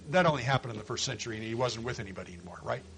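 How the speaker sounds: low, hurried, dropping away; muttered fast sceptical aside; mock doubt. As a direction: toss off a silly theory quickly and quietly. Voicing a mistaken view as aside.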